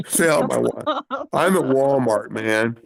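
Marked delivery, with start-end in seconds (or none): none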